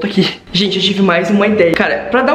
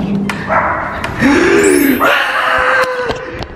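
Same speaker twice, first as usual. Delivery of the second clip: excited gasp. Real, not acted. Finds the door open.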